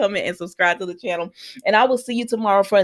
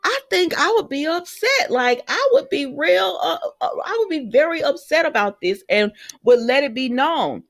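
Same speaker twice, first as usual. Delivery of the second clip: emphatic retort; 6 semitones higher than usual. Retold or imitated. The vlogger imagining her own reaction to a CPS call, high and indignant. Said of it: "And I was like".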